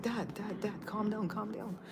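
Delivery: imitating a daughter, very soft; hushed calming plea. Daughter tries to calm her angry dad, hushed. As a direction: quietly talk him down.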